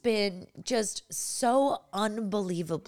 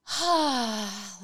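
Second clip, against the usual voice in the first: a big relieved toddler sigh, voiced high; imitated deep sigh; 5 semitones higher than usual. Mother imitating daughter's deep breath, relieved sigh. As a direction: mimic the daughter's sudden reset breath. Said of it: "she'll go".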